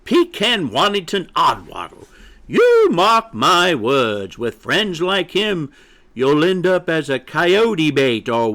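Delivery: indignant, high-pitched hen lecture; scolding mother hen. Acted. Mother hen warns chick about his friend, indignant. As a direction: warn him grandly, stretching key words.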